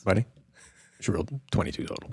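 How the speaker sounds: soft, fast, unvoiced delivery of bad news; quiet bad news. Real, not acted. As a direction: break it to him softly and quickly. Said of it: "Buddy, buddy".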